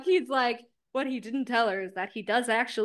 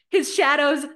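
ordinary talk, nothing marked

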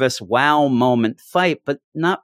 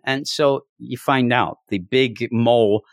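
ordinary delivery

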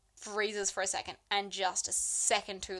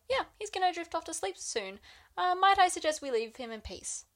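soothing priest; 8 semitones higher than usual. Retold or imitated. Father Orville voiced.

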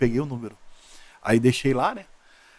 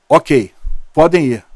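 slow firm go-ahead; 10 dB louder, much slower than usual. Retold or imitated. Retold go order.

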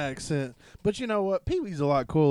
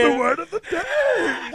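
put-on very high, squealed kids' show host; high-pitched playhouse cheer; Pee Wee Herman. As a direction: echo it in a laughing high squeal. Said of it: "And then Pee Wee would".

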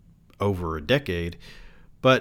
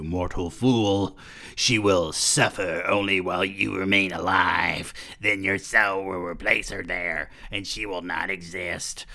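sneering Lucifer. A narrator's reading. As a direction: sneer it out as a cruel devil. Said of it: "Lucifer's lips curled into a sneer".

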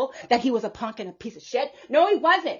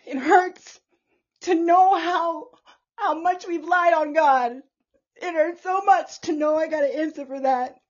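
high, strained, near tears; sorrowful confession; tearful apology; 5 semitones higher, 5 dB louder than usual. Real, not acted. She apologises to God for lying about him, tearful. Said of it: "I'm sorry".

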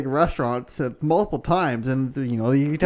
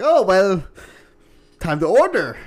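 loud mock waiter, slightly raised pitch; waiter imitation; 5 semitones higher, 6 dB louder than usual. Waiter returning to the table for the order, lightly imitated. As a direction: play the returning waiter with emphatic loudness. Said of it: "come back and be like".

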